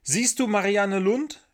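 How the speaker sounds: calling up from below; shouted question. A narrator's reading. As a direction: shout it up like a boy. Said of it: "schrie Michel".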